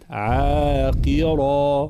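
عاقرة slowly with a light ع; slow careful عاقرة; correct recitation. Shown as a demonstration. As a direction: say عاقرة without overdoing the ع. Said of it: "عاقرة هكذا".

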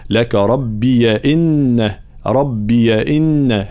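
slow ربي إن read as rabbiya; ya with fatha. Shown as a demonstration. Teacher models ربي إن, slow and deliberate. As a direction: drag out ربي into rabbiya before إن. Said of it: "نحركها بالفتح".

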